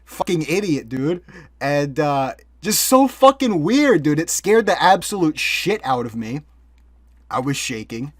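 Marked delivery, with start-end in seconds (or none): 2.6-5.8 emphatic. Real, not acted. Reliving a frightening encounter.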